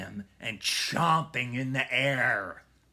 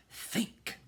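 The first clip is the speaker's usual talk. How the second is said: whispered thought; 4 dB quieter than usual. A narrator's reading. Jack's anxious inner thought.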